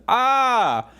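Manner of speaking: long, high scream of pain; Arnold Schwarzenegger. Acted. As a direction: hold a hammed-up agonized scream. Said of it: "Arnold Schwarzenegger, and he's".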